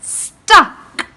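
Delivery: loud, high call of the word; big storytime chant of the word; emphatic refrain. Acted. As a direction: shout it out with the kids. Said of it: "What's the words?".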